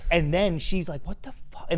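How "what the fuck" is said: shocked whisper. Retold or imitated.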